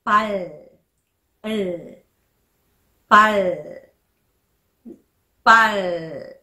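パル ending in an English-style L, no u vowel; final L sound. Shown as a demonstration. Teacher demonstrates L batchim, held final L. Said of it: "ル発音が出ます".

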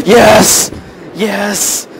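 high excited yell, easing on repeat; triumphant shout. Real, not acted. Gamer celebrating a hit, triumphant shout.

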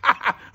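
short, loud ha-ha; gloating laugh. A narrator's reading. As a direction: bark a laugh at your own joke. Mister Dart laughs at his joke.

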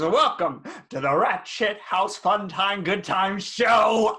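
loud game show welcome; announcer shout. Acted. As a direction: shout the show title like a host. Host shouts show title.

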